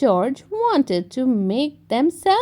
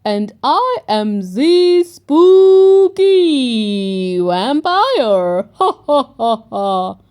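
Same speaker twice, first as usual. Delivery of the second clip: loud, hammed-up vampire with a laugh; 7 dB louder than usual. A narrator's reading. Grandpa Pig in his best vampire voice, hammed-up and loud. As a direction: ham up a spooky vampire, laughing. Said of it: "in his best vampire voice".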